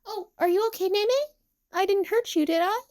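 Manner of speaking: high-pitched anxious questions; worried checking. A narrator's reading. Emu worried she hurt Nene, anxious asking. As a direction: ask it high, lifting at the end.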